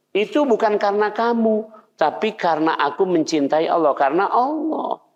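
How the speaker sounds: high, sincere reply; Imam Ahmad replying. Retold or imitated. Imam Ahmad explaining to his neighbour, high and sincere. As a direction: answer kindly, voice lifted high.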